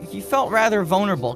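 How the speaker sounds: ordinary talk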